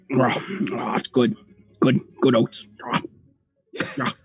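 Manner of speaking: horse talking between chomps; munching horse. Acted. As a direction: chomp through the words like a feeding horse. Voices a horse munching oats.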